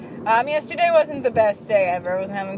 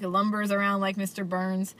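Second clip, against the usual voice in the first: usual speaking voice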